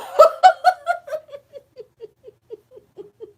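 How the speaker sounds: loud, high helpless laughter; cackling until nearly in tears; long high cackle. Real, not acted. Host cracking up at her own joke, loud high cackle.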